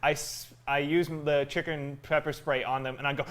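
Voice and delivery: normal talking voice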